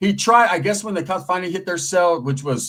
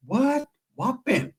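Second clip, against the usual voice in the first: high, softer startled question; acted surprise; 9 semitones higher, 4 dB quieter, much slower than usual. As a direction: play astonished at the news. Acting shock at bad news.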